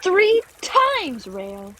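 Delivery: high outburst dropping off on the name; worked-up reproach; exasperated. Acted. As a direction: lose patience with a friend. Clover snaps at repeated question.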